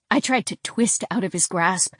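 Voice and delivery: plain reading voice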